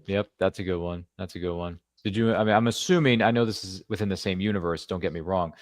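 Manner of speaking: normal talking voice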